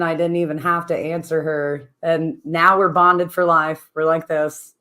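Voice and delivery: ordinary talk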